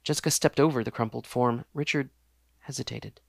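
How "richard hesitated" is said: ordinary delivery, nothing marked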